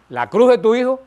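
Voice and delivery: loud and high, hammering the point; emphatic rising shout. Real, not acted. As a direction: call it out to the congregation. Predicador recalca, sube la voz. (exclamación enfática y aguda)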